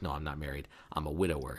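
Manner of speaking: low, soft acted admission; subdued confession. He tells her he is a widower, low and subdued. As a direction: drop the voice for his confession.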